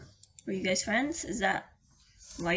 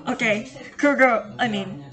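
louder, hammed-up therapist voice; mock therapist; 2 semitones higher, 9 dB louder than usual. Streamer playing therapist to Kruger, hammed-up. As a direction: play the shrink to the game character. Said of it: "I am becoming the therapist now".

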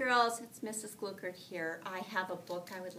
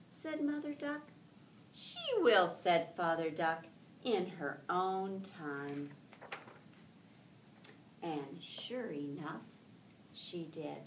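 lively storybook read-aloud with voiced ducks; reading to children; 7 dB louder than usual. Teacher reading a picture book aloud to her class, lively and gentle.

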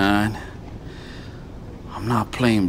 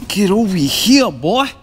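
high, loud, pumped-up call to the fish; real thrill of landing a big bass; excited call; 8 semitones higher, 4 dB louder than usual. Real, not acted. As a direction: yell it up at the fish as it comes in.

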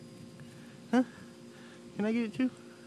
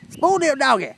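loud teasing; 5 semitones higher, 6 dB louder than usual. Real, not acted. Teasing dog loudly.

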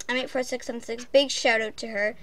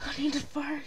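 whisper; 8 dB quieter than usual. Real, not acted. Whispered confession.

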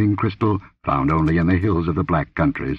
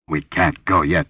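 grunted; 2 semitones lower than usual. Acted. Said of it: "he grunted".

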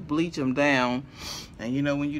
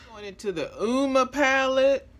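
excited; 8 semitones higher, 8 dB louder than usual. Real, not acted. Excited makeup announcement.

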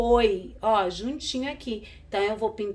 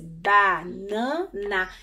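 "Banana" slowly split into ba, na, na; syllable by syllable; much slower than usual. Shown as a demonstration. Teacher showing children the parts of a word, syllable by syllable. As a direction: stretch each syllable of "Banana". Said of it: "pra gente identificar as sílabas".

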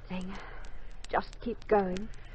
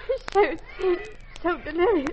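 high, louder anguish; distraught; 11 semitones higher, 5 dB louder than usual. Acted. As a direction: near tears. Distraught nurse.

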